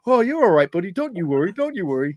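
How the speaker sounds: fake-comfort the man you just punched, breaking into laughter; soothing cop. Cop placating the man he flattened, high mock-soothing voice. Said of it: "He's like".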